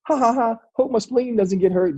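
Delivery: high, carefree mock laughing jest; joking. Player on the plane to Denver, joking carelessly about his spleen. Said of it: "just, like, joking, being a dummy".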